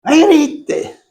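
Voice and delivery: very high-pitched acted outcry; mock high-pitched protest; mock protesting cry. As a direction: cry it out high, like someone objecting to a yell. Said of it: "alguien lo gritó y dice".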